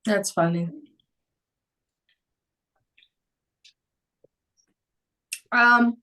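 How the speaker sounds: talking with candy in mouth; words round a piece of candy; mouth full. Real, not acted. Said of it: "sorry, I had candy in my mouth".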